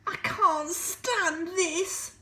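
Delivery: very slow, high and loud complaint; exasperated Mister Bear. Acted. Mister Bear kept awake, slow high exasperated wail. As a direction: drag every word out in despair. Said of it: "said Mister Bear".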